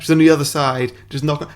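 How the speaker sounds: usual speaking voice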